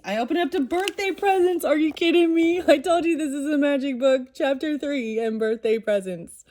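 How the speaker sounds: high, loud, thrilled disbelief; amazed excitement. Real, not acted. To her viewers about the book, amazed and thrilled. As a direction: squeal at the magic book's pick.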